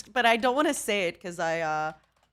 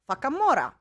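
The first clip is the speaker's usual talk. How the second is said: imitated kid's Russian accent, a bit higher; 4 semitones higher, 4 dB louder than usual. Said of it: "a really Russian accent".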